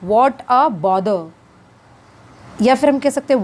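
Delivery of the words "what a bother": slow teaching demo. Shown as a demonstration. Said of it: "तो English में कहेंगे".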